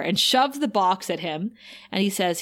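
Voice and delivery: usual speaking voice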